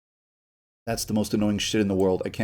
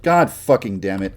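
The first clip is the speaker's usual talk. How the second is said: ordinary talk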